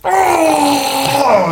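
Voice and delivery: sudden high cry of pain. Acted. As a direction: yelp out, hit but still going. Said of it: "He's like".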